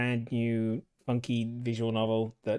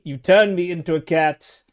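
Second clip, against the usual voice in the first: louder, higher mock outrage; indignant outcry; 4 semitones higher, 10 dB louder than usual. Real, not acted. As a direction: protest being turned into a cat. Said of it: "you monster".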